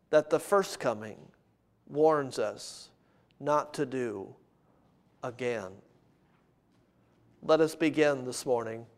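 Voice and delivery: low, hushed, deliberate pulpit delivery; measured sermon opening. Real, not acted. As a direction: open the sermon softly, pausing between phrases.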